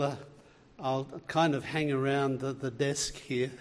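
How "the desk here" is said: ordinary talk, nothing marked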